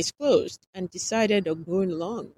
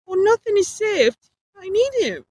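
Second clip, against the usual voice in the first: high, loud pleading; cling to him desperately; desperate insistence; 12 dB louder than usual. Acted.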